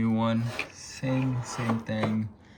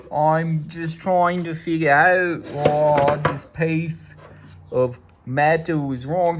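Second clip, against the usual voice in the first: put-on British accent; 2 semitones higher than usual. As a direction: play at being British, pitched well above your own voice. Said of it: "my British accent".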